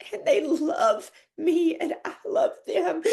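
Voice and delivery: slow, tearful and breaking; crying. Real, not acted. Host talking of friends who love her, crying. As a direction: let it crack as you slow down. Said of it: "I've cried it all off".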